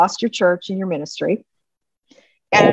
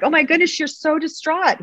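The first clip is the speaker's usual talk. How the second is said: sympathetic alarm; 10 semitones higher than usual. Retold or imitated. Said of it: "I'm like".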